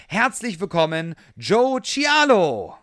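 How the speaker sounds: announcer. Acted. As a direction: announce the guest's name big. Host announces guest's entrance.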